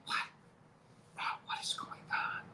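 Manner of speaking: breathy whisper, barely audible; hushed, unvoiced what-is-happening; whispered disbelief. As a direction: whisper it in shock as if to yourself. Stunned by sudden stranger.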